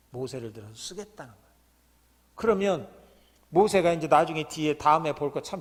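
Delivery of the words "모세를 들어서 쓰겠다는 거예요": soft echo. Real, not acted. Preacher echoing his point to the congregation, softly. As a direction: repeat it much softer, almost to yourself.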